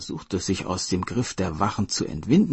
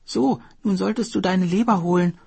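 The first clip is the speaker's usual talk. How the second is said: a hint of the earnest turtle, higher; 12 semitones higher, 5 dB louder than usual. Turtle reminding the hare to fetch his liver, earnest. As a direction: remind him of his promise. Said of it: "sagte die Schildkröte".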